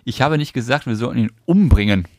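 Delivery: "umbringen" hit hard at the end; stress on UMBRINGEN. Shown as a demonstration. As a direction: lean on "umbringen". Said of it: "Und jetzt nochmal den letzten?".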